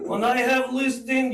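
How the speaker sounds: playful Russian accent for a laughing cohost. Shown as a demonstration. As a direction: repeat the line in a thick Russian accent. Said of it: "I can do in the Russian voice".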